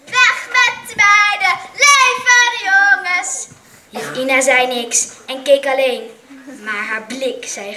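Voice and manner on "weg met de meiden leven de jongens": high, loud slow chant; high-pitched shriek. A narrator's reading. Ibrahim on his chair shrieking slogans for the boys, high and loud. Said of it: "gilde met hoge stem".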